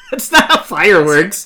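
loud, slow laughing disbelief. Real, not acted. Laughing at movie logic.

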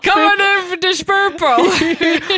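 loud, high, slow mock Dutch accent; ridiculous singsong Dutch villain bellow; Davy Jones gone Dutch. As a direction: draw out a silly, slushy Dutch accent. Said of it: "Davy Jones would have sounded ridiculous".

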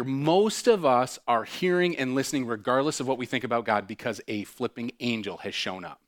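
ordinary talk

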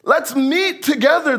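high, sing-song fake friendliness; mock friendly invitation. Pastor mimics enemies sweetly inviting Nehemiah, mock friendly. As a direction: lure him in sweetly, voice high. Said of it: "Now they're being all friendly".

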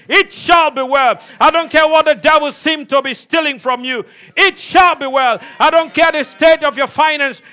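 loud, driving prophetic declaration; preaching at full force. Acted. Preacher prophesying over the congregation, shouting. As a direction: declare it over them, building.

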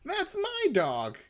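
high, slow, shocked cry; exclaimed. Acted. Reading the comic, exclaiming as the dog's owner. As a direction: Koichi crying out over his dog. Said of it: "Koichi exclaimed".

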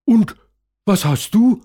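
put-on high, curious child voice. A narrator's reading. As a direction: ask like an eager kid. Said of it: "will Alexandro von Caroline wissen".

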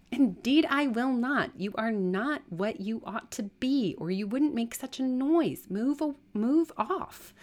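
high, loud, emphatic scolding; indignant refusal. Acted. Servant ordering a beggar away, indignant. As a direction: drive them off with a drawn-out final order.

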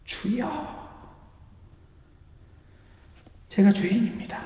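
imitating a mother weeping in prayer; crying out to God. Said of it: "어머니가 부르짖기 시작합니다".

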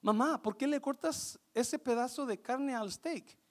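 quietly puzzled child's question, lightly acted; daughter. Daughter asking her mother about the steak, lightly voiced daughter. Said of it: "y su hija le dijo".

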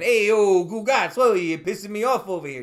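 loud, high mock Italian-American tough guy; fake Italian. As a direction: bark it like a Jersey wiseguy. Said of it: "I do a lot of the fake Italian".

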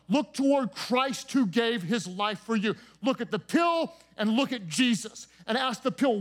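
rousing pulpit preaching, pushing each question; preaching at full force. Acted. Pastor preaching to his congregation about addictions, rousing sermon voice.

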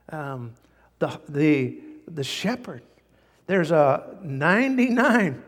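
ordinary delivery

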